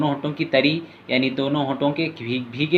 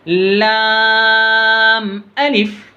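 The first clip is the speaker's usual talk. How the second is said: slow, high, louder chanted lam alif; drawn-out recitation; 6 semitones higher, 5 dB louder, much slower than usual. Shown as a demonstration. Teacher demonstrates the lesson's letters to pupils, slow chanted and louder. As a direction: hold लाम very long, then a falling अलिफ. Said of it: "आखिर में आवाज़ नीचे की तरफ जाना".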